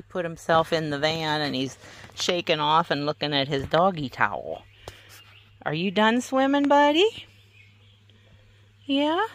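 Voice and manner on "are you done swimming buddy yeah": high rising pet voice. Real, not acted. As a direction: ask the dog sweetly up high. Asking the dog.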